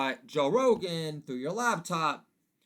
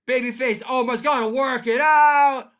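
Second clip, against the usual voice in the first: loud; 8 semitones higher, 11 dB louder than usual. Acted. Loud comic aside.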